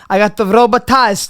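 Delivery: ordinary talk, nothing marked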